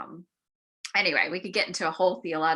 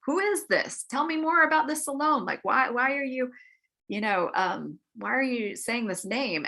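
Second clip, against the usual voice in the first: much higher, curious demanding questions; intrigued king; 7 semitones higher than usual. Retold or imitated. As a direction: press him eagerly about the name. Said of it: "Cyrus is like".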